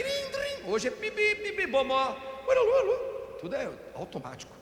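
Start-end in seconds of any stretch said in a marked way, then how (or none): 0.0-0.7 bell imitation. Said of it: "Antigamente era crim". 0.7-3.4 horn imitation. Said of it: "imitating horns and sirens".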